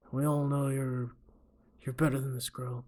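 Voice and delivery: measured tough-guy coach; a lightly put-on movie tough guy, held back; Clint Eastwood. Coach advising his fighter, Clint Eastwood impression. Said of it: "what's a good Clint Eastwood impression".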